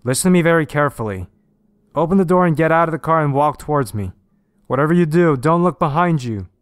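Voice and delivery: policeman shouting orders. A narrator's reading. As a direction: shout commands across to someone in danger.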